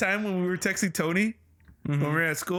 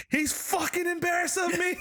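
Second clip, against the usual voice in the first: mock scream, higher and laughing; retold scream; 10 semitones higher than usual. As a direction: yell it out half laughing at a friend. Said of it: "I just screamed".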